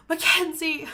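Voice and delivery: high, loud scolding name-call; imitating grandma's loud mock outrage; caught-out exclamation. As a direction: cry out the name, busted. Grandma realizing the joke.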